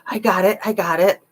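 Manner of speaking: smacking lips between words. Real, not acted. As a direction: wet mouth clicks as she talks. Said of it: "Sorry for the lip smacking".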